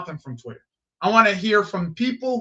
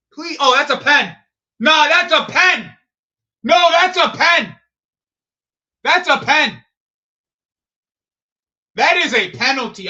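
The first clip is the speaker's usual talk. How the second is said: loud, high shouting at the referee; outraged shout; 4 semitones higher, 7 dB louder than usual. Real, not acted. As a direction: yell "that's a pen" like a furious fan. Reacting to a missed penalty.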